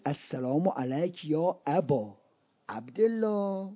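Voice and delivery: very soft, low reverent recitation; hushed salutation. Acted. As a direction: let the greeting sink almost to a murmur. (سلامی آهسته و با احترام)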